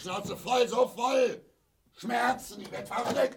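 high, loud rant, climbing in fury; furious outburst. Acted. Father in pain raging that he is fed up, furious outburst. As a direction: yell it like the pain is driving you mad.

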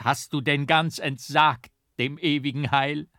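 put-on old monk crying out, high and loud. A narrator's reading. Monk crying out to his lost brother, loud and high. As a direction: cry out in horror at the red cross. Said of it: "Da schrie Cyrillus laut".

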